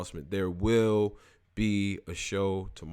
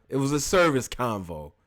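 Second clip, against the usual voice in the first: normal talking voice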